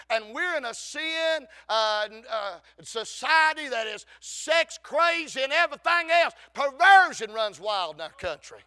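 loud, rising revival preaching; full-force preaching. Acted. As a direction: belt out the scripture to the congregation.

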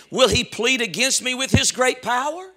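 "power" rising sharply as a question. Shown as a demonstration. Preacher reading Job's question to the congregation, questioning. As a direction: read it as an open question, lifting on "power". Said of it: "Question mark".

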